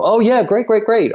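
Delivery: high-pitched rush of eager approval; retold excitement. Choreographer retelling the brainstorm of dance ideas, excited. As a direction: imitate keen agreement, pitched well up.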